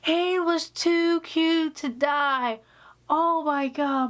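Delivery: slow mock mom voice; mom gushing. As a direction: drag out her line in disbelief. Repeating mom's line.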